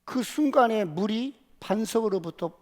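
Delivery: normal talking voice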